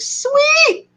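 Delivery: high, drawn-out squeal of delight; excited cheer. Retold or imitated. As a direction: celebrate a lucky find in a high voice. Thrilled at finding address nearby.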